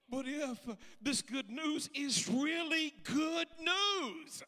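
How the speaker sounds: loud, high, stretched word by word; slow preaching shout. Acted. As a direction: proclaim every word slowly. Preaching climax.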